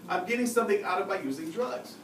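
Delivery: usual speaking voice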